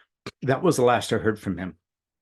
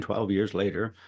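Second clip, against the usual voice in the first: normal talking voice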